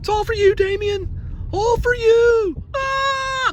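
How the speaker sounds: very high, slow eerie voice; haunt it like a possessed child; high eerie voice ending in a shriek; creepy demon voice. Pretend. Spooky Omen voice at object.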